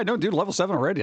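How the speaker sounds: usual speaking voice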